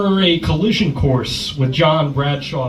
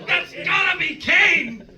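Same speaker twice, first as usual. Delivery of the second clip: much higher, slow shout; excited shout; 12 semitones higher, slower than usual. Real, not acted. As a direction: yell the guess again. Co-host repeats guess.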